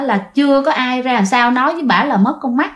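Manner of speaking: ordinary delivery, nothing marked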